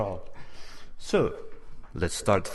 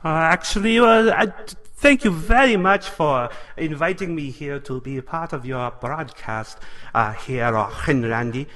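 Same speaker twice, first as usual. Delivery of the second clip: a light mock German accent; put-on German accent; 2 semitones higher than usual. Weatherman Doctor Strangelove greets Randy, in a light mock German accent. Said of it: "That's an interesting accent".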